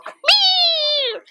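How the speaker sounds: piercing, drawn-out squeal of a meow; high-pitched cat meow. Retold or imitated. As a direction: imitate a cat's shrill squeak, held long. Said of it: "he had a very high-pitched voice".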